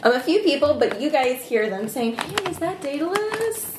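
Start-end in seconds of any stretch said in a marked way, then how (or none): 2.2-3.7 curious townsperson. Acted. GM voices townsfolk calling out.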